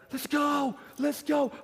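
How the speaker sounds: high, loud hype yelling; hyped shout. Shown as a demonstration. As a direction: pump them up with a shout. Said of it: "that's not good coaching".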